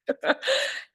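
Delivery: high teary laugh; emotional laugh. Real, not acted. Laughing to her fiancé through real emotion. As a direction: laugh through welling tears. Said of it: "Why am I emotional?".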